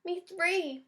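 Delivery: usual speaking voice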